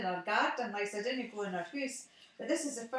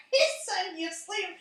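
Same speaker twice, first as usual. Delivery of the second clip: bursting into a loud laugh, higher; 9 semitones higher, 8 dB louder than usual. Real, not acted. On the phone, laughing about Alan asleep beside her. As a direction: laugh out the news he dozed off.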